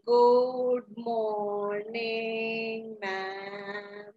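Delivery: every vowel dragged out very slowly; affected school-style drawl; drawled greeting. Shown as a demonstration. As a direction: stretch "Good morning" out lazily and sweetly. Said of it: "like the way you wish good morning".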